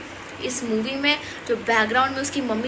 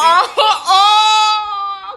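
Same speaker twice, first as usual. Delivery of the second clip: mocking howl; 7 semitones higher, 7 dB louder, much slower than usual. Real, not acted. Mocking friend's bad luck.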